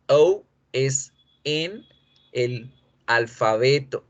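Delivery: ordinary delivery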